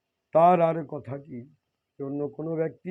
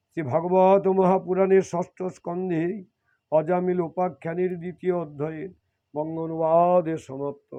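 ordinary talk

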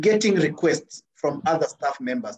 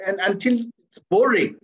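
normal talking voice

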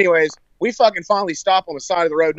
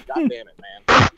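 normal talking voice

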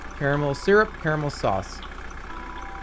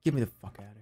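ordinary talk, nothing marked

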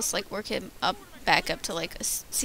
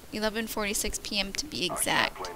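ordinary talk

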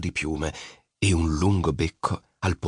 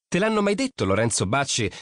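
molto più alta, canzonatoria; ragazzina ironica; 7 semitones higher than usual. A narrator's reading. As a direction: prendilo in giro con voce alta. Nina canzona Lori. (Nina canzona Lori, voce alta)

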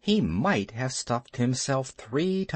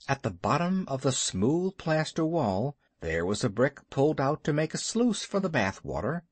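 usual speaking voice

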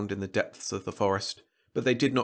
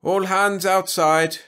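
loud, high, long-drawn order; slow ringing shipboard call; 9 semitones higher, 7 dB louder, much slower than usual. A narrator's reading. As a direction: holler it to the whole crew. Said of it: "He turned and called".

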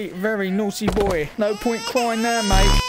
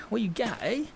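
ordinary talk

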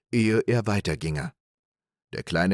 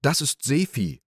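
higher, proud little voice; sly reply; 7 semitones higher than usual. Acted. Little Klaus boasting to big Klaus, slyly. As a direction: announce it like a marvel. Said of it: "erwiderte der kleine Klaus".

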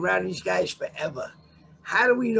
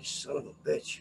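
muttered under breath; 3 semitones lower, 9 dB quieter, slower than usual. Retold or imitated.

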